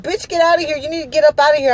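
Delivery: high, imitated guard ordering someone out; exorcist outburst. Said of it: "went from regular to fucking exorcist".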